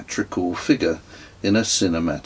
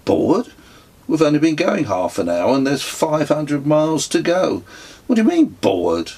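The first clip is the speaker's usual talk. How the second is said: higher and louder, disbelieving; exasperated dad, half amused; father scoffing at his bored son; incredulous father; 7 semitones higher, 4 dB louder than usual. Acted.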